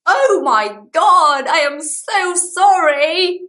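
high, loud, slow flustered apology; mortified apology. Retold or imitated. Mortified woman blurting an apology to a stranger, high and loud. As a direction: gasp it out, horrified at the wrong car. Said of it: "And I go".

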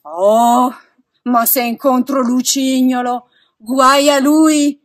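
loud, indignant threat; theatrical angry warning; threatening. A narrator's reading. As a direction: vow revenge at full voice, drawing out the end. Pinocchio threatens Lucignolo.

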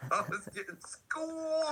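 SCHOOLED stretched long and high; hammed-up, long-held "schooled"; drawn-out awe. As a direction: draw out "schooled" in high-pitched amazement. Awed retelling of being taught.

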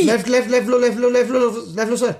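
pressing squad callout; urgent directions. Real, not acted. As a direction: steer the driver away from danger. Urgent steering orders to driver.

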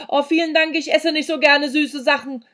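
loud, high polite refusal; said loudly. A narrator's reading. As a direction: decline loudly for a deaf hostess. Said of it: "sagte laut".

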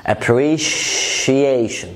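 "appreciation" very slow, with a long held "shhh"; "appreciation" broken up around a lingering sh; shia sound, exaggerated. Shown as a demonstration. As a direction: drag out the sh inside "appreciation". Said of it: "نطق بيبقى عامل زي شيا".

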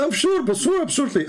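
shrill high mimicry of certainty; imitating Abdul. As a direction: insist like the cocky caller. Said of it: "He said".

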